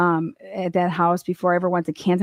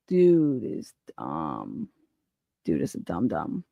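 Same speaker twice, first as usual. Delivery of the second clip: much lower and very slow, dragged out; mock dumb voice; 6 dB quieter, slower than usual. Host mocking the fired trooper as dumb, lower slow drawl. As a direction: drawl it low like a dimwit. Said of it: "repeats dumb in put-on voice".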